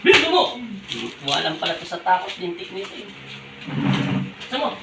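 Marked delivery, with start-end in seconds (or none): 0.0-0.4 high, loud sudden command; shouted order. Real, not acted. Healer urging spirit, shouted. 3.1-4.7 slow, breathless whispered order; whispered urging. Real, not acted. Healer urging spirit, whispered.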